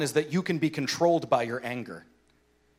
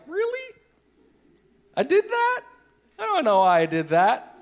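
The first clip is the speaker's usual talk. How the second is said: much higher, puzzled disbelief; bewildered child; 12 semitones higher, 5 dB louder than usual. Retold or imitated. Father voicing his daughter next morning, bewildered. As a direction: play the daughter baffled by what she did.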